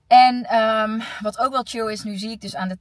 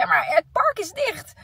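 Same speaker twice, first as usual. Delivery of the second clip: exasperated; 10 semitones higher, 5 dB louder than usual. Retold or imitated. Retold exasperated reply to colleagues.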